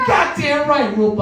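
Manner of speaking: high-pitched, hyped-up cheering on; rousing support. Retold or imitated. Standing up for his coworker Rupa, hyped up. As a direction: egg her on like a rally.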